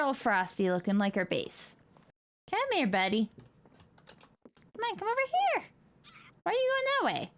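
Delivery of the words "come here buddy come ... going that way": high coaxing pet voice. Real, not acted. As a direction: coo at the pet.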